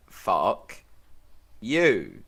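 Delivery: drawn-out defiance, a beat between the words; deliberate, spaced-out defiant answer; defiant reply. Acted. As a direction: spit back a refusal one word at a time. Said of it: "Titus's response to being told to lie down".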